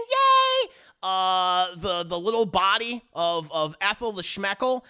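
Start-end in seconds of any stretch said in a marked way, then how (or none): 0.1-0.7 very high, loud yay; jubilant cheer. Retold or imitated. Acting them all celebrating, jubilant cheer. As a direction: stretch out a squealed cheer.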